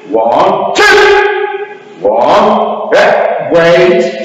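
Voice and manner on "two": sharp, high drill shout; loud shout. Shown as a demonstration. Instructor cueing a downward block, loud shout. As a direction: shout the count high and sudden. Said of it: "And a big shout".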